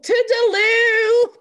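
very high, loud, drawn-out mock British goodbye; hammed-up British farewell whoop. Host signing off the podcast, in a shrieked mock British farewell.

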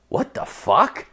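high, slow rising question; baffled disbelief. Retold or imitated. Man finding a mess by the toilet, baffled. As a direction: squeak it out in mock bewilderment.

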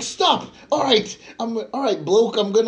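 high-pitched mock British accent; Bert Kreischer. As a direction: imitate a comic's bad British accent. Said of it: "so he did a British one".

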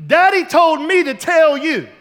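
loud, imitated little-girl sass; mock bossy and emphatic; sassy daughter. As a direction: announce it like a kid with daddy's backing. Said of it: "with all kinds of sass".

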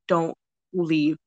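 slow, drawn-out mock plea. Friends to the returning friend, slow mock plea. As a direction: stretch each word, imitating a plea.

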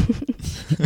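quick breathy giggle; nervous laugh. Real, not acted. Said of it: "you're just giggling away".

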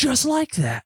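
high-pitched imitated exclamation; mock excited recognition. Todd recognising the caller's voice, imitated excitement. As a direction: leap up in pitch, playing an amazed coworker.

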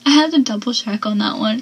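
usual speaking voice

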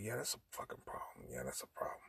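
whispered flat refusal; hushed whisper. Retold or imitated. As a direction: drop to a whisper, firm. Refusing her staying over.

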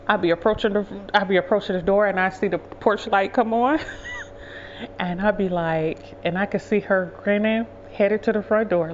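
usual speaking voice

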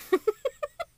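high wobbling spring sound; door stopper noise. Retold or imitated. Showing co-host how it bounced, door stopper noise.

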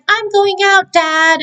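put-on high-pitched teenage announcement; imitated defiant daughter. Daughter heading out past her father, mock defiant, high.

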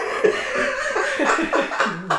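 high bursting laugh; real high laughter. Real, not acted. Laughing at own impression.

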